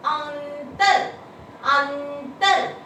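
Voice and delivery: अंतर stretched very slowly so the nasal on अं is heard; slow sounding out. Shown as a demonstration. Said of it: "कहाँ आ रही है आवाज?".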